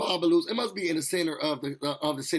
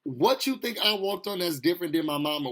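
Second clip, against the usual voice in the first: ordinary talk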